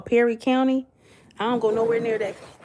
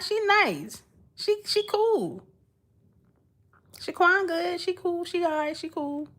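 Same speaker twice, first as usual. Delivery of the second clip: high-pitched, drawn-out vouching; playful reassurance; 7 semitones higher, slower than usual. Pretend. Streamer vouching for her alter ego to the chat, playfully reassuring. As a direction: say it high and lilting, easing off toward the end.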